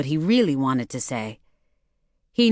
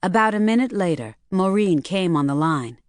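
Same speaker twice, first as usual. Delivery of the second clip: ordinary delivery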